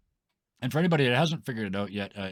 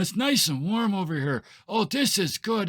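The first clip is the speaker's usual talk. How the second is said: put-on old person voice; 9 semitones higher, 3 dB louder than usual. GM voices contented old people.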